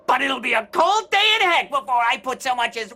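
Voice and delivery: high-pitched shouted refusal; indignant shouting. Acted. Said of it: "Stop shouting, you must".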